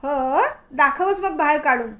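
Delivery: loud defiant dare; mocking loud challenge; shouting back. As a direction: yell the challenge right back at him. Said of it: "ती पण ओरडत म्हणाली".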